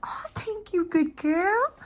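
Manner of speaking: high cooing praise. Real, not acted. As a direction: praise the dog sweetly. Praising the dog.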